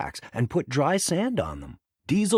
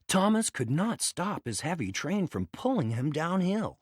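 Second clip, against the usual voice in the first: ordinary delivery, nothing marked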